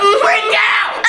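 high loud cheer; excited shout. Real, not acted. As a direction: yell it out wildly.

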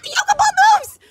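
high, loud amazed shout; excited surprise. Real, not acted. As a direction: shout it out in thrilled discovery. Startled delight at moving ball.

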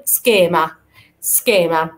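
سكيما with a clear hard k after s, ske-ma; teacher models سكيما. Shown as a demonstration. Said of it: "بننطقها".

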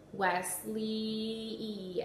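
"Wesley" drawn out, final E held and punched; stretched name. Shown as a demonstration. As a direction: stretch "Wesley" and lean on the last E. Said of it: "stretch out their whole name and punch out the last sound".

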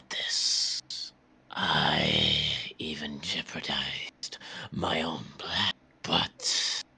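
hushed, menacing villain whisper, very slow on the first line. Acted. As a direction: breathe the threat out without voice.

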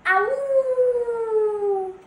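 long, loud, high howl; drawn-out high wolf cry. Acted. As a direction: howl "awoo" like a fleeing wolf. Chased wolf cries out.

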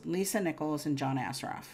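ordinary talk

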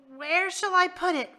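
high, loud, choked question; strangled croak. Acted. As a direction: squeeze it out with a hand on your throat. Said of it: "his voice a strangled croak".

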